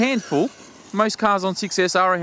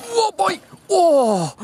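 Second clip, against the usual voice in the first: startled yelp; 13 semitones higher, 3 dB louder, much slower than usual. Real, not acted. Car crashes into fence.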